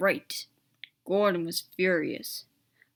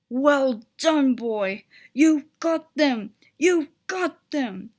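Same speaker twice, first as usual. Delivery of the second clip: cheering; 4 semitones higher, 7 dB louder, slower than usual. A narrator's reading. Driver cheering Edward on.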